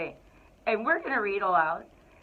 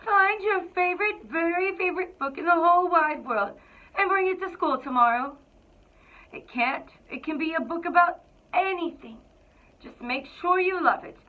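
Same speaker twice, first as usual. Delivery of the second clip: higher, bright-voiced teacher Miss Joy announcing homework; teacher's voice; 7 semitones higher, 5 dB louder than usual. Miss Joy tells the class their homework, cheery higher teacher voice. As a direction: sing it out like a cheerful teacher giving an assignment.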